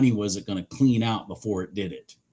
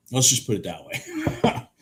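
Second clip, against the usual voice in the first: laughing through the words; 2 semitones higher than usual. Real, not acted. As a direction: chuckle while repeating it. Repeats line with a laugh.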